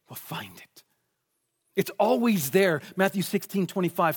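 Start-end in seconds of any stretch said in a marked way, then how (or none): 0.1-0.8 breathy hushed whisper, much softer. Acted. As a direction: drop to a whisper on the promise. Scripture quote ending in hush.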